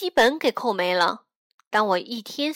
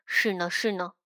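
soft, low agreement; quiet voice; 5 semitones lower, 6 dB quieter than usual. A narrator's reading. Brother secretly agrees about the nurse, quietly. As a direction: agree under your breath. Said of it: "我小声说".

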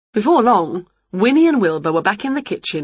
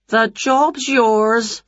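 higher, very slow jolly lady; jolly lady voiced; 4 semitones higher, much slower than usual. A narrator's reading. The kitchen lady hires Wilbur, in a higher, very slow voice. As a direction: give it out slowly and warmly. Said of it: "said the lady".

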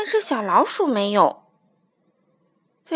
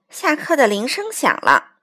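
usual speaking voice

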